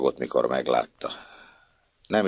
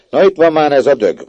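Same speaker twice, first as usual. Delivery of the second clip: louder, higher contemptuous yell; angry scolding; 11 semitones higher, 11 dB louder than usual. Acted. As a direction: snap at the girl as she comes in. Household scolds the child. (dühös kiabálás)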